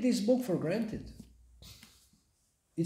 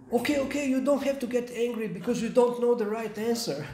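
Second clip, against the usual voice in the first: flustered lion backing down; 5 semitones higher, 5 dB louder, faster than usual. Acted. Beaten lion backs down.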